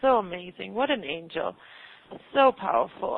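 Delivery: ordinary talk, nothing marked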